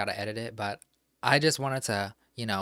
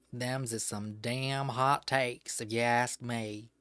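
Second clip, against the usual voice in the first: put-on Southern accent. Streamer mocks fandom drama for chat in a hammed Southern accent. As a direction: play a fed-up Southern old-timer in a Southern accent. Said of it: "Love me some Southern accent".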